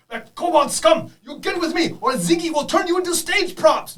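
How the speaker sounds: high, loud, slow villain screech; high shrill-voiced boss raging at his minions. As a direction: shriek the threat like a desperate boss. Boss villain threatens kobolds.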